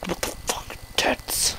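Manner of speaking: whispered what the fuck, tits; retold whisper. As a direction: hush it like a shocked aside. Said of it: "I was like".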